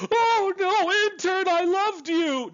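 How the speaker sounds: over-the-top grieving crewmate, mocking it; mock melodrama. Mocking a soap-opera crewmate mourning the intern, hammed-up wail. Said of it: "Nothing ridiculous or stupid or amateur".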